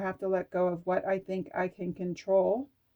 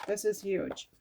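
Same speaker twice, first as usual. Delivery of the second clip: ordinary talk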